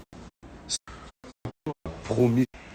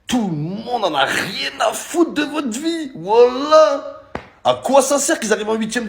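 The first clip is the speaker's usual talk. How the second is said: mocking taunt; 9 semitones higher, slower than usual. Real, not acted. Mocking the Swiss.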